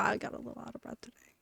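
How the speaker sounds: quick, breathy whisper; quick whispered confession of being winded; embarrassed whisper. Real, not acted. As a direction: mutter it sheepishly. Sheepish aside about breathlessness.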